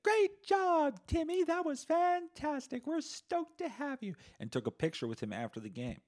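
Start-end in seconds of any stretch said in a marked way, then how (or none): 0.0-4.1 loud, very high falsetto congratulations; gushing mom. Acted. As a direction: cheer like a fake-proud mother posing for a photo. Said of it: "came into the dressing room and was like".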